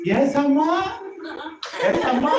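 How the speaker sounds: high, rising answer to mother; obedient little child. Acted. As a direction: answer the call like a small child. Little Krishna answers his mother.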